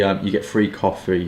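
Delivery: ordinary delivery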